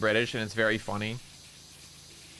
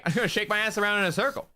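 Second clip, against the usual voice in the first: higher, silly quoted line of his own voice; mocking Skinwalker echo; 9 semitones higher, faster than usual. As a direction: parrot his own dumb line back, a touch hammed. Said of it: "It was just me saying".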